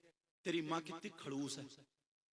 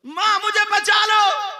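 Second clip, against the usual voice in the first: higher, louder, slower plea for help; desperate cry; 23 dB louder, slower than usual. Acted. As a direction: cry out to mother in a panic. Girl begs mother, cried out.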